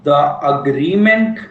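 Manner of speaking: words drawn out with long gaps, very slow; slow dictation. Shown as a demonstration. Teacher to class, giving point nine for notes, very slow dictation. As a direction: dictate it so students can write along.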